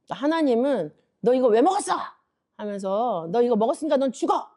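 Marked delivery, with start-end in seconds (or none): 1.2-1.9 louder, higher mock scolding; angry scolding. God imagined scolding Eve for eating the fruit, angry scolding. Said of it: "이렇게 말씀하시는 게 아니라". 3.3-4.5 fast, higher-pitched mock threat; angry condemnation. God imagined condemning the sinner on the spot, angry condemnation. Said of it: "이렇게 말씀하시는 게 아니라".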